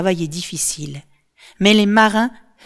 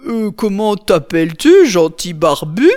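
thick, slurred giant's voice, louder; a drunk cyclops mumbling his question; drunk slurring; 7 dB louder than usual. Acted. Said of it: "bredouille-t-il d'une voix pâteuse".

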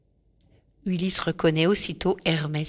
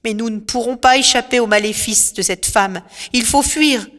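insistent plea; 4 semitones higher, 5 dB louder than usual. Acted. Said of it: "Euryloque insiste".

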